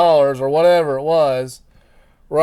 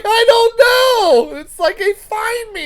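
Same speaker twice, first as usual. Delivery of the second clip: helpless whine; 6 dB louder, slower than usual. Retold or imitated. Answering Amber in a helpless voice.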